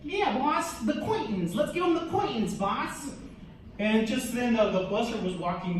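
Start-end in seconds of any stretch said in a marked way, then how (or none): 0.0-3.1 put-on keen henchman pitching to the boss in a New York accent; hammed-up New York mobster flunky; New York gangster sidekick. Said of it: "like, old-time gangsters, from, like, New York".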